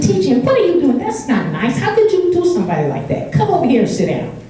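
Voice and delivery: put-on high-pitched scolding of a child; a grandmother scolding her grandson, mildly stern; mock scolding.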